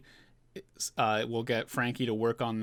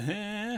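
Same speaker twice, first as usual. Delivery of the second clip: high, drawn-out "eh"; mimicked "eh"; 10 semitones higher than usual. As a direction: imitate a silly face with a high-pitched noise. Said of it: "they're all doing the".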